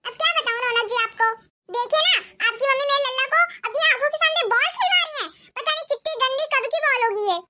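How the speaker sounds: a bossy bahu telling off her mother-in-law; shrill daughter-in-law. Acted. Ekta complains to husband. (सास को डाँटती तेज़ बहू, ऊँची आवाज़ में)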